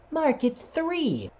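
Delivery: overly patient teacher spelling it out; teacher correcting. Retold or imitated. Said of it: "my teacher said the same thing".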